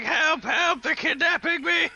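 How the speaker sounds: put-on high, loud squeaky old-man plea; mock cry for help. Imitating the RPer yelling for help, high old-man squeal. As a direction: squeal it like a fake kidnapping victim. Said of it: "he's just like".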